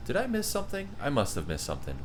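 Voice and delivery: ordinary delivery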